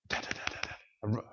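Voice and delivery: rapid unvoiced da-da-da patter; imitated running footsteps. Storyteller imitates the sound the boy hears, quick pattering footsteps. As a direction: tap out running feet with the voice. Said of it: "he hears this sound".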